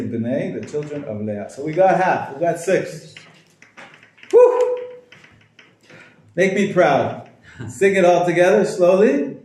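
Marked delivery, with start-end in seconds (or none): 4.3-4.8 high, loud woo; a big whoop of cheer; teacher whooping to rally the class. Real, not acted. 6.4-9.4 rallying. Real, not acted. Rallying class to sing.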